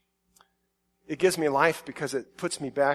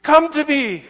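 high, loud desperate cry to flee; father crying out; 10 semitones higher, 11 dB louder, much slower than usual. Retold or imitated. Father calls his daughter out of the mansion, in a high loud desperate cry. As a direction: shout to pull her out of danger. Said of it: "and he cries out".

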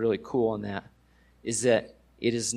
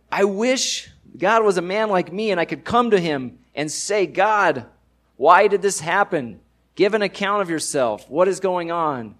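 pleading, louder and higher; crying out to God; 7 semitones higher, 7 dB louder than usual. Retold or imitated. Job crying out to God in his pain, loud pleading. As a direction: call up to heaven demanding answers. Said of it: "Job starts crying out to God".

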